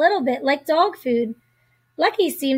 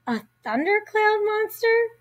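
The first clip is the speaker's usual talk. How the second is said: surprised; 8 semitones higher than usual. A narrator's reading. Clara doubts scary piñata.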